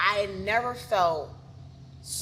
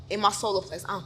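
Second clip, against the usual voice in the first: usual speaking voice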